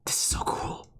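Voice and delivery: excited breathless whisper. Real, not acted. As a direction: hush it in awe. Excited at game reveal.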